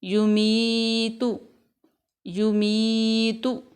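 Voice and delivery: युमीतु very slow and drawn out; slow model reading. Shown as a demonstration. A teacher models युमीतु slowly for students. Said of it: "next लफ्ज़ है युमीतु".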